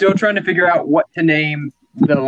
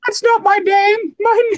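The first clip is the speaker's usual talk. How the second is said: much higher, nervous office underling; nervous employee voice; 13 semitones higher than usual. Acted. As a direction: squeak it out like a scared clerk. Timid employee in office improv.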